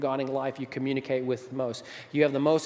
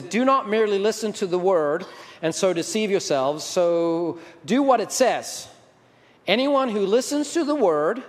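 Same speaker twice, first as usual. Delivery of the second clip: loud, raised-pitch pulpit reading; emphatic scripture reading; 6 semitones higher, 6 dB louder than usual. Acted. Preacher reading James to the congregation, emphatic and loud. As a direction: proclaim the verse with force.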